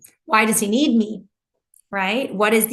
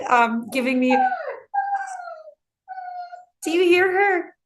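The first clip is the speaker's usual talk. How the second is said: ordinary talk, nothing marked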